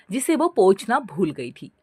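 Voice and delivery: usual speaking voice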